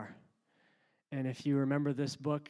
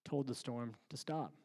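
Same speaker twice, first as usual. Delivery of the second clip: ordinary talk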